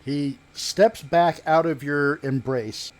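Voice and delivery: normal talking voice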